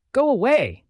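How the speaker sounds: high, loud yelled dismissal. A narrator's reading. Woman yelling at Neo from behind the door, yell. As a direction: yell it, pitch jumping up. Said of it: "she yells".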